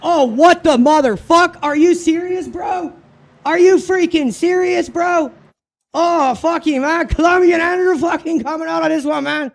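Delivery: furious high yelling; exasperated bro rant, high and loud; frat bro. Real, not acted. Raging at AI crash in race.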